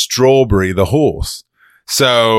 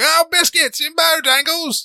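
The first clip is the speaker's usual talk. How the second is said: very high hammed-up Cockney; high put-on Cockney hawking; Cockney audition voice. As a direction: squawk it like a Cockney biscuit peddler. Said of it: "did the audition like that too".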